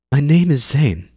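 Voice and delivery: put-on a kid proudly says her name; young girl voice. Zane introduces herself to Eivor, high and girlish.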